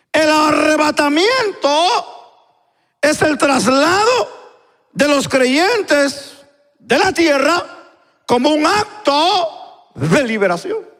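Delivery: high, slow phrases with held endings; emphatic preaching. Acted. Preacher leads congregation through the definition again, emphatic. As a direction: proclaim it slowly, phrase by phrase.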